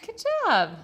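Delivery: high, loud cheer; very high-pitched, celebrating; excited praise. Real, not acted. Praises child for reading word.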